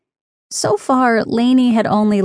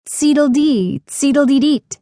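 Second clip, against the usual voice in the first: high spoken bird call; voiced imitation of a whistled bird call; imitated birdsong whistle; 5 semitones higher than usual. A narrator's reading. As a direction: chirp it out like a chickadee. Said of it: "a whistle that sounded like".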